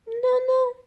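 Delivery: very high little cake voice; tiny high refusal; squeaky no. A narrator's reading. Cake refusing the wolf, squeaky high no.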